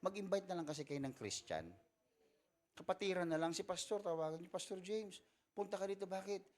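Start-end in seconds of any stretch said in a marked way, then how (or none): 3.5-6.4 excited caller. Retold or imitated. Church member phoning the pastor.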